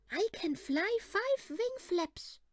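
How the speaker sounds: high, slow, boastful little-bird call; proud shout. Acted. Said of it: "But when he shouted".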